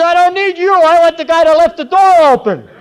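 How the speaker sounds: loud, high-pitched punchline yell; shouted retort. Acted. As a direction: holler back across the room, building. Punchline shouted back across the room.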